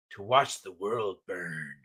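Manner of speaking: mock sinister announcement; ominous villain. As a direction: growl it out like a doom prophet. Ominous line, slow deep.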